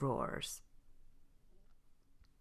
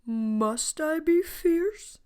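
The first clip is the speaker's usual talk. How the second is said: doubtful lion; 13 semitones higher, 7 dB louder, slower than usual. A narrator's reading. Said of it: "said Leonard".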